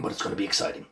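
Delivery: soft unvoiced whisper. Shown as a demonstration. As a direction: breathe it as a whisper. Whispered, then repeated aloud.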